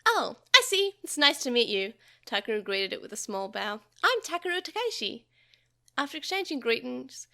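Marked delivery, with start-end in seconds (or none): 0.0-1.9 put-on child voice. A narrator's reading. Child greets creature. 4.0-5.2 put-on child voice. A narrator's reading. Child introduces himself.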